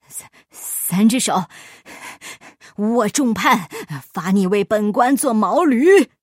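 stammering then loud furious sentence; angry official. Acted. Guan furiously sentencing Afanti to be his donkey, angry. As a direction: rage and hand down the punishment.

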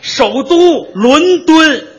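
"伦敦" said slowly and deliberately, pitched high, as "lún dūn"; slow correction. Shown as a demonstration. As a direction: spell out "伦敦" syllable by syllable. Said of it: "这，这，这，行". (一字一顿纠正"伦敦")

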